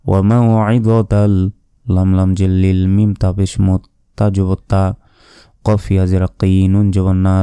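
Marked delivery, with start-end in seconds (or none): none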